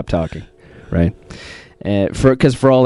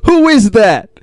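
dramatic challenge; 7 semitones higher, 4 dB louder, slower than usual. Retold or imitated. Confronting the possessing creator, retold.

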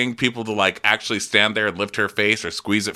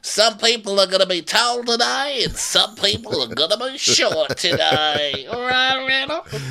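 hammed-up smug Erica; imitating Erica. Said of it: "it cuts back to Erica like".

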